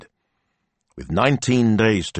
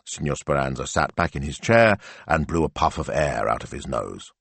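usual speaking voice

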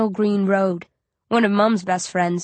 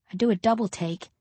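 plain reading voice

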